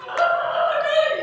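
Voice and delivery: high, loud fake scream of fright; mock panicked child. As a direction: shriek it like a terrified child, hammed-up. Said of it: "she's not".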